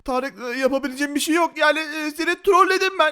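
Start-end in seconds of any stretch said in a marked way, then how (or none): none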